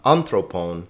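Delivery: anthropon with AN stressed, the rest dropping; wrong first-syllable stress. Shown as a demonstration. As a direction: hit the opening of anthropon. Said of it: "because thro has the accent".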